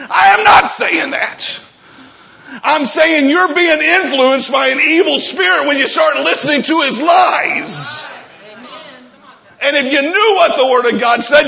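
loud, high, emphatic sermon shout; full-force preaching. Real, not acted. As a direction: thunder it out to the congregation.

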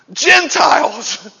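ordinary talk, nothing marked

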